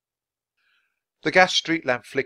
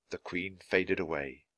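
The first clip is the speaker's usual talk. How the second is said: usual speaking voice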